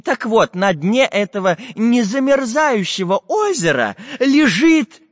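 high, eager professor voice; eager old professor. Acted. Professor's voice held.

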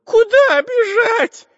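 very high, slow, loud cartoon voice; baffled skinny robber. A narrator's reading. Skinny robber asks his chief where to run, high and baffled. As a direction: squeal it in slow bewilderment. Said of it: "не понял худой разбойник".

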